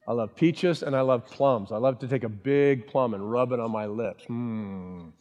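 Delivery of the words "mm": long, low, soft "mmm"; drawn-out hum of relish; savouring hum. Pretend. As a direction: hum as if relishing ripe fruit. Savouring an imagined ripe plum.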